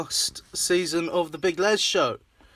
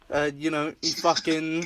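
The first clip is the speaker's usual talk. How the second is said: ordinary delivery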